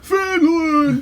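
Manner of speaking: "Finland!" shouted high and loud; very high loud "Finland!" with echo feel. Shown as a demonstration. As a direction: boom "Finland!" as if echoing. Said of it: "much better".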